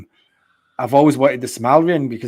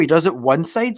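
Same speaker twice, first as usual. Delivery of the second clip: usual speaking voice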